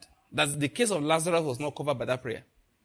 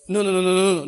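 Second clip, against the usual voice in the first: loud repeated no, building; preacher's ringing denial; emphatic shouted no; 2 semitones higher, 7 dB louder, faster than usual. Real, not acted. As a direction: hammer each no out loud.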